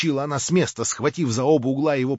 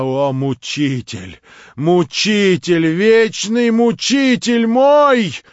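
нарастает, медленно, очень громко и высоко; выкрикни горе и гнев; отчаянный упрёк; 8 semitones higher, 10 dB louder, much slower than usual. Acted. Варвара в отчаянии. (нарастающий горький упрёк)

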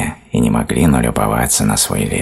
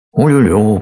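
high, loud ululating whoop; storybook hedgehog's bird call; bird-like squeal; 8 semitones higher, 6 dB louder than usual. Acted. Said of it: "по птичьему заверещал ёжик".

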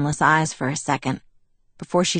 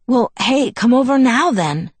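eager, higher-pitched burst; sudden enthusiasm; 7 semitones higher, 4 dB louder than usual. A narrator's reading. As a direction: perk up suddenly with an eager invitation. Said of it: "he said with sudden enthusiasm".

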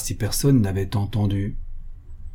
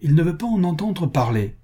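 higher-pitched, exasperated report; understated fury, pitched up; furious; 8 semitones higher than usual. A narrator's reading. Baroness reports the duke's refusal, mildly furious. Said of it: "répondit la baronne furieuse".

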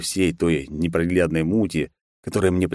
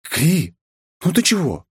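indignant; 12 semitones higher than usual. Acted. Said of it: "возмутилась княжна".

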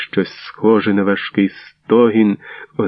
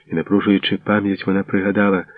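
plain reading voice

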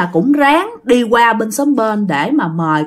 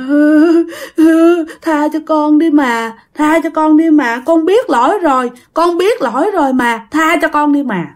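moaning plea; 5 semitones higher, slower than usual. Retold or imitated. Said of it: "ổng chỉ rên thôi".

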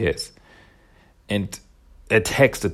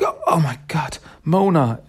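higher-pitched mock gasp of shock; 6 semitones higher, 3 dB louder than usual. Parents see their mutated daughter on video, shocked. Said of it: "They're shocked".

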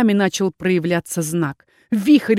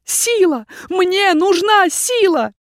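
pleading shout; 10 semitones higher, 4 dB louder, slower than usual. A narrator's reading. Calling raven for power.

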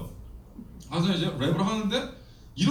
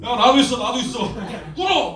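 much louder, higher order to kneel; commanding shout; 5 semitones higher, 6 dB louder than usual. Retold or imitated. To the juvenile detention kids, a mock commanding shout. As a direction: bark it at the kids.

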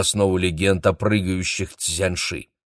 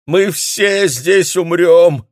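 very high, drawn-out doom-laden wail; loud moan; 11 semitones higher, 9 dB louder, much slower than usual. A narrator's reading. Hotel guest panics in front of the others, moaning loudly. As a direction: cry out slowly and loudly in despair. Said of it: "громко простонал тот".

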